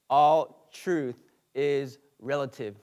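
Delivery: word by word, firmly; very slow. Retold or imitated. Customer repeating his claim on request, very slowly. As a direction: spell it out slowly with a gap after each word. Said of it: "repeat that really slow".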